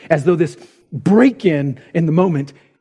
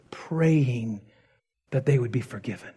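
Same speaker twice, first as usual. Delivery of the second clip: whisper; 4 semitones lower, 6 dB quieter than usual. Real, not acted. Moses kneeling in prayer.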